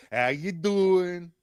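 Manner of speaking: loud, drawn-out New York greeting; New York accent. Acted. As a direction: play the New York sandwich guy, stretched and loud. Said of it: "I hear your New York ... accent".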